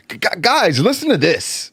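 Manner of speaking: teasing his co-host by mimicking him in a high, excited voice; mock excited presenter. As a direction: play the eager YouTuber cueing a clip. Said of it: "Be like".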